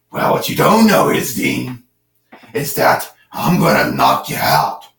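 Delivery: low rasping whisper of a caped vigilante; strained laryngitis-style Batman growl; gravelly Batman. Acted. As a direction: growl it out like a hoarse Dark Knight. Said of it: "like you got laryngitis or whatever".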